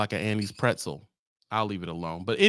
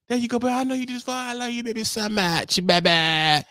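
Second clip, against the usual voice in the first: sweet wife; 9 semitones higher than usual. Retold or imitated. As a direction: coo it like a wife faking love. Wife voiced in falsetto.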